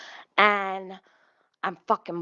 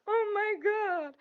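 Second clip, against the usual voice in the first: high, laughing oh my God; laughing disbelief; 13 semitones higher, 3 dB quieter than usual. Retold or imitated. Reacting to the reporter's story, high and laughing. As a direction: squeal it through a laugh. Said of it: "And I was like".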